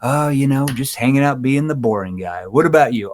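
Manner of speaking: easygoing, joking delivery, voice lifting at the end; playful tone. Shown as a demonstration. Agent chatting with prospect at the door, playful. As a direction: tease lightly like an old friend. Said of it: "Now I'm saying in a playful tone".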